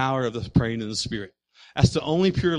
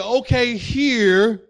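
slow demo; 8 semitones higher, 5 dB louder, much slower than usual. Shown as a demonstration. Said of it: "doesn't mean okay in Portuguese".

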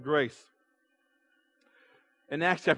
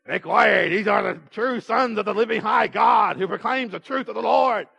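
put-on possessed voice, higher than usual; demon-possessed voice; 3 semitones higher than usual. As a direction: cry it out like someone possessed. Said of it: "this girl talking in a demon-possessed voice".

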